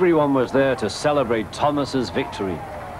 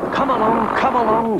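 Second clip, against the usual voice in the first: high, lilting singsong urging; half-sing it high to urge them on; 12 semitones higher, 3 dB louder than usual. A narrator's reading. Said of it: "sang Thomas".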